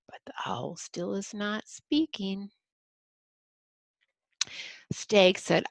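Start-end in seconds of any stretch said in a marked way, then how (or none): 0.1-2.5 whispered narration. Acted. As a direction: hush the line to a breath.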